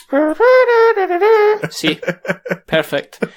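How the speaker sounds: sax impression with thumb in mouth. Shown as a demonstration. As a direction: hum through the thumb in your mouth. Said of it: "works if you put your thumb in your mouth".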